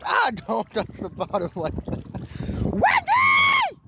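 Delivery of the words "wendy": very high shout across the water. Real, not acted. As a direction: yell her name far off. Shouting to mom.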